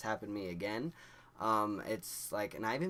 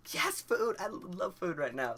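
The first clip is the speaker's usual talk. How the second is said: much higher, louder delighted squeal; giddy excitement; 10 semitones higher, 4 dB louder than usual. Retold or imitated. Offered McDonald's while high.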